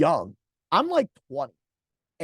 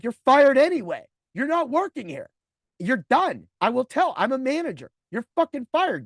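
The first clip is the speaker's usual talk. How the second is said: loud, high heated firing; angry firing; 6 semitones higher, 5 dB louder than usual. Retold or imitated. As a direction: lay into the driver as his boss. Manager fires rude driver.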